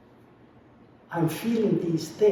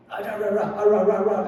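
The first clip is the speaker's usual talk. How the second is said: mock babbling; 5 semitones higher, 6 dB louder than usual. Retelling a dream to spouse.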